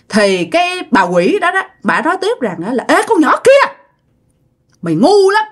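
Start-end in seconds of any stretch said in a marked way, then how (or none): 2.9-3.7 shrill ghostly scolding; ghost woman warning. Retold or imitated. Ghost woman warns the girl about the boyfriend. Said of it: "giọng của bả nghe vang vang, rền rền". 4.8-5.4 high eerie "mày ngu lắm"; shrill ghost scolding. Retold or imitated. Ghost woman scolding the foolish girl. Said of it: "giọng của bả nghe vang vang".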